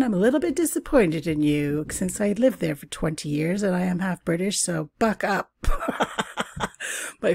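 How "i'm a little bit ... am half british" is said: normal talking voice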